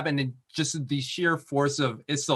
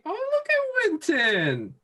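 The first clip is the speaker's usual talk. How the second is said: high, slow, gushing over Winton; beaming proud parent; slower than usual. Real, not acted. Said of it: "You sound like a proud parent".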